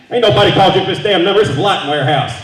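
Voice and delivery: loud, fast, annoyed shouting; angry clerk. Retold or imitated. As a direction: snap at the caller.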